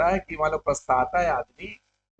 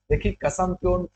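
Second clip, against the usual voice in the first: usual speaking voice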